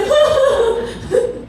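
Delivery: bright burst of laughter; real amused laugh; high laugh. Real, not acted. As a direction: laugh at the memory. Laughs at own story.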